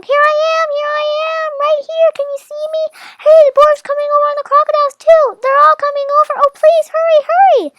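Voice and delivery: very high, loud desperate calling; high pleading yell, fast and frantic; dragon yelling. Acted. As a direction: wave and shout for rescue.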